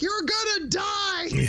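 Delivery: high, slow, drawn-out imitation of a screaming kid; mock panicked yell. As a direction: stretch out a boy's terrified warning. Acting out boy yelling on bridge.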